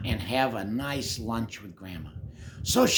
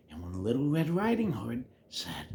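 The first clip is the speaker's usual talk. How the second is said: ordinary delivery, nothing marked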